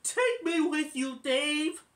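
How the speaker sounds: put-on high, loud begging kid voice; Alvin's pleading chipmunk voice. Alvin begging Dave to take him on the trip, high and loud. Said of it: "And Alvin says".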